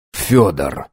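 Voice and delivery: plain reading voice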